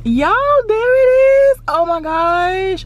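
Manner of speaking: high, loud, slow gasp of delight; overjoyed, voice leaping up at the big reveal; excited squeal. Real, not acted. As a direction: shriek with joy at seeing it. Sees her new house arrive.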